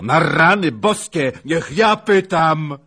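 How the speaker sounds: loud, high, drawn-out plea; frantic outburst. Acted. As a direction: cut in desperately at full voice. Frantic demand to do questioning. (gwałtowny, rozpaczliwy okrzyk)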